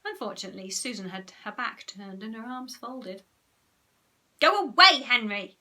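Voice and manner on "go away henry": loud bossy command; ordering. A narrator's reading. Margaret orders Henry away, loud and bossy. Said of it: "ordered Margaret".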